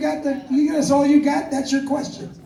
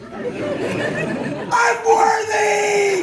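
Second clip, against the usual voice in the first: slow, high cry of victory; triumphant shout; 8 semitones higher, much slower than usual. Pretend. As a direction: bellow it like lifting the hammer. Lifting hammer, triumphant Thor moment.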